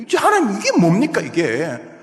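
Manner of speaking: loud, high, impassioned complaint; indignant protest. Acted. Congregation protesting to God over the epidemic, indignant protest. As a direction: plead with God in mounting exasperation.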